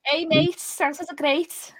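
high, slowed Scouse tribute; put-on Liverpool accent. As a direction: cheer on Scousers in their own accent. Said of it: "Scouse is, is a comforting accent".

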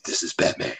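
low gravelly Batman whisper; Batman growl. Retold or imitated. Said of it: "if he ever went evil".

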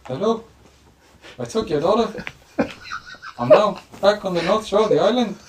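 high-pitched Hawaiian turtle overlord on the phone; mock Hawaiian kidnapper's call; Hawaiian accent. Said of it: "give us your best Hawaiian accent".

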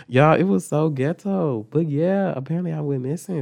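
normal talking voice

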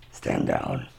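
very low, gruff command; deep voice. Acted. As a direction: drop the voice way down and order. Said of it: "Oh, that was deep".

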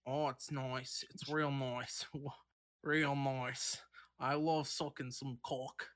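put-on Australian accent slipping away, trailing into a low mumble; stroke-addled Australian accent. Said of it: "Australian man had a stroke".

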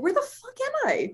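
very high-pitched disoriented question; bewildered. Retold or imitated. Client coming out of meditation, bewildered. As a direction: act out comic total disorientation. Retelling bewilderment after session.

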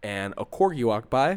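ordinary talk

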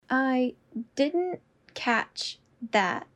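slow, each word apart. Shown as a demonstration. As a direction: say it slowly, word by word. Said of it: "repetition drill, slowed take".

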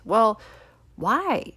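usual speaking voice

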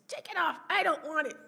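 high, loud mock outburst; imitated angry refusal. Mom refusing oxygen from the nurse, mock angry. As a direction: act out mom's refusal, loud, nearly laughing.

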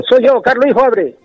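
child mimicking mother's Sevillian voice, pitched high; imitating his mother. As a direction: squeak it up like a little boy copying mom. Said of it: "imité la voz de mi madre exactamente".

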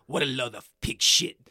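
loud, hammed-up French accent; mock-French character line. Shown as a demonstration. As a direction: quote a game character in a thick French accent. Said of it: "trying to think of a French accent".

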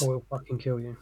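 quiet menace, lightly done as Batman. Retold or imitated. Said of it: "is Batman's".

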